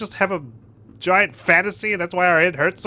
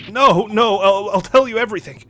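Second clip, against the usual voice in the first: high, loud stammering plea; acted fright, giving in at once; panicked surrender; 4 semitones higher, 5 dB louder than usual. Prisoner threatened with the cold ocean, loud panicked plea. Hero yields to sailor's threat.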